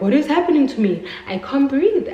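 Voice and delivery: panicked. Retold or imitated. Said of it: "I'm like".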